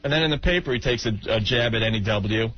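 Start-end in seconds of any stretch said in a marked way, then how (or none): none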